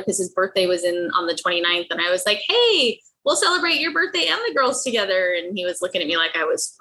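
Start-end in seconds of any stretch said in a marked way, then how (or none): 2.5-5.3 druggy cheer. Retold or imitated. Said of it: "I was on a little bit of drugs".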